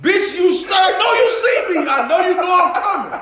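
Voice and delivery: yelling at a friend who won't come out; mock yell. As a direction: holler it up high through a laugh. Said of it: "I be like".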